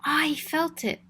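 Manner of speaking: ordinary delivery, nothing marked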